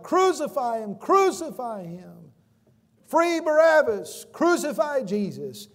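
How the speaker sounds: high, loud, slow mob chant; crowd shouting. Acted. Mob crying for crucifixion.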